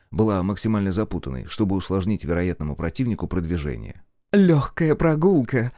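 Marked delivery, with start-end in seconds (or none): 4.3-5.7 протяжно и свысока, с фырканьем; пренебрежительно фыркнуть, растягивая слова. A narrator's reading. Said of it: "пренебрежительно фыркнула Винора". (пренебрежительное фырканье)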